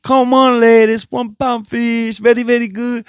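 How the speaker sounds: mock street-vendor sales chant; imitating the one pound fish seller. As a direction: hawk fish to passing ladies like the one pound fish guy. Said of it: "And he's doing the".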